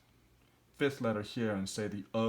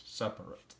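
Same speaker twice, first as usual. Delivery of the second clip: quiet model word; 5 dB quieter than usual. Shown as a demonstration. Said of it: "three syllable split".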